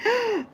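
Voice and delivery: high shocked intake of breath; sudden startled gasp; sharp gasp. Real, not acted. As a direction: gasp in real alarm. Reacting to a story in chat.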